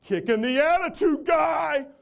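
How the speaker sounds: high, loud, slow shout from above; mock shout across a showroom; retold yell. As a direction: call it down from the balcony. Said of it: "somebody on the second tier yell down".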